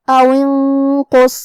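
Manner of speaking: انقص with the نون hidden in a nasal hum before a heavy قاف, slowly drawn; slow او انقص, hidden nasal نون then a full-mouthed قاف; ikhfa demo. Shown as a demonstration. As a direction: recite او انقص slowly, hiding the نون with ghunna. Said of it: "نون کو چھپاتے ہوئے".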